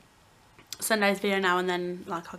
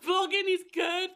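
laughing self-imitation; 10 semitones higher than usual. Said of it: "And I was like".

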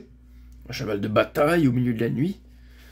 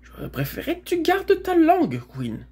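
high, exasperated reproach; exasperated sigh; 3 dB louder than usual. A narrator's reading. Girl reproaching her mare, exasperated. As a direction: scold her with weary exasperation. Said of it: "soupira la jeune fille".

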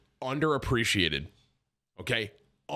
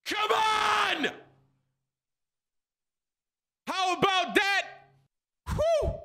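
victory shout; much slower than usual. Real, not acted. Gamer cheering his solved Wordle, real excited shouting. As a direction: let it rip after nailing the word.

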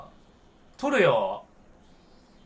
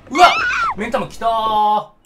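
loud, high cry as the eyeball appears; startled shout; 4 semitones higher, 12 dB louder than usual. Real, not acted. Gamer yelling as the eyeball monster shows up. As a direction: yelp at the sudden monster.

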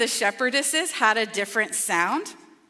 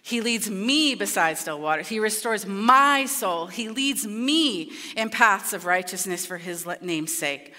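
scripture reading; 4 semitones lower than usual. Acted. Reading the psalm aloud.

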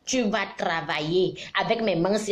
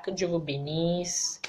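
whispered blessing, softer and lower; 3 semitones lower, 5 dB quieter, slower than usual. Real, not acted. Blessing her viewers at the close, whispered.

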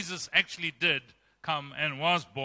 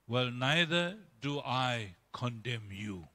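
slow, soft, lower forgiving voice; gentle Jesus; 5 semitones lower, 5 dB quieter, slower than usual. Retold or imitated. Jesus gently forgiving the accused woman, slow and soft. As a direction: let the mercy land slowly.

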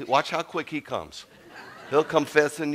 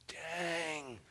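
soft, higher, drawn-out "dang"; quietly dismayed at himself; 4 semitones higher, 12 dB quieter than usual. Retold or imitated. Retelling his dismay at her crying.

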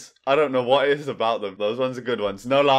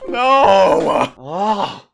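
anguished cry; 7 semitones higher, 9 dB louder than usual. Real, not acted. Reacting to shock.

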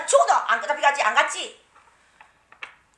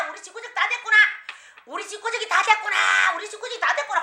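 lamenting mother; 6 semitones higher, 4 dB quieter than usual. Retold or imitated. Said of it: "날로 퍼붓는데".

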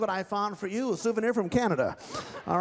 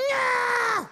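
puppet lady voice; 5 dB louder than usual. Acted. Ventriloquist voices volunteer lady puppet.

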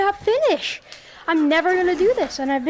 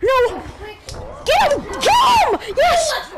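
frantic shouts; 8 semitones higher, much slower than usual. Real, not acted. Gamer screaming during the final fight, frantic shouts. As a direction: scream them on in panic.

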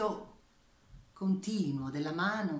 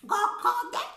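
hen cluck; 6 dB louder than usual. Acted.

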